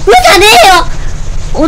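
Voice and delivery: loud high protesting yell; indignant shout. Real, not acted. Player yells at the game after the shock, loud and high. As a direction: yell at the screen in disbelief.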